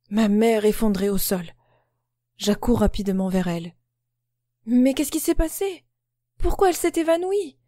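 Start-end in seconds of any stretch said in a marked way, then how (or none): 4.7-7.6 high frantic cry, much higher; screaming. A narrator's reading. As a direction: scream it in panic. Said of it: "hurlai-je". (hurlé, en panique)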